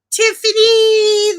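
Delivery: high, loud, drawn-out name call; excited call-out. Real, not acted. Streamer spotting a viewer in chat, excited call-out. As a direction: sing out the name in delight, slow and high.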